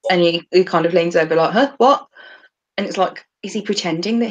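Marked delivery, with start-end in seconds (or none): 1.5-2.1 higher, louder questioning "Huh, what?"; imitated feigned deafness; playing dumb. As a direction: act as if you didn't hear. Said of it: "this sort of playing, playing dumb".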